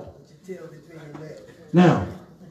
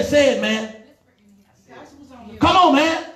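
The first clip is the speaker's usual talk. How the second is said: preaching exhortation; 8 semitones higher than usual. Acted. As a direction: press the boy hard to confess before God. Preacher urging the boy to confess.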